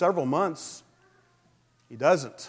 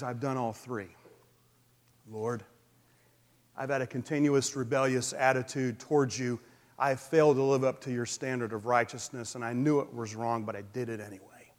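softer, lower contrite prayer; hushed confession; 5 semitones lower, 7 dB quieter than usual. Acted. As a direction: confess it quietly, as if alone with God. David's quiet confession to God.

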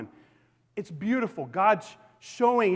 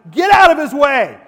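much higher and louder, full-force exhortation; preaching shout; 8 semitones higher, 14 dB louder than usual. Acted. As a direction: blast it to the back row. Climax of sermon exhortation.

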